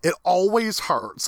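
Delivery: half-hearted pained cry, no real shouting; mock scream. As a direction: act out a scream without fully yelling. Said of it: "and he's screaming it".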